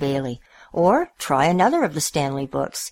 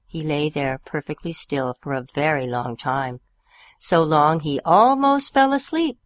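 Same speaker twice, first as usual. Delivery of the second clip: plain reading voice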